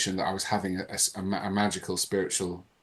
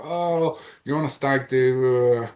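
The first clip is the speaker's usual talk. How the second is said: high, drawn-out group jeer; mock jeering; 4 semitones higher, 5 dB louder, slower than usual. Mates react to his last-day-of-drugs announcement, mock jeering. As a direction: imitate mates scoffing, dragging out the words.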